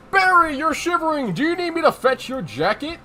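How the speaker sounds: high, hearty, solicitous boss; lilting offer of help; jovial conductor. Acted. Conductor offering the rookie a jacket, jovial.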